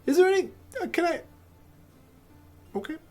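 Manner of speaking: high, loud confused barkeep; squeaky flustered barmaid voice. Acted. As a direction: barkeep squeaks at the rushing player. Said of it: "she goes".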